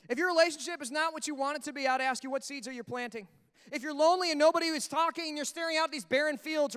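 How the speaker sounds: usual speaking voice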